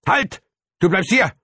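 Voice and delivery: high, loud yell to stop; shouting. A narrator's reading. Father shouts at child jumping out the window, shouting. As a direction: yell it after someone escaping. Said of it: "schrie mein Vater".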